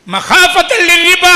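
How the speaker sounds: മഹാഫത്തല്ലിൽ said loud, high and drawn out; loud slow recital. Shown as a demonstration. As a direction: hammer മഹാഫത്തല്ലിൽ word by word. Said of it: "എന്തൊക്കെ കയറി നിന്നില്ല?". (മഹാഫത്തല്ലിൽ ഉറക്കെ, പതുക്കെ ഊന്നി)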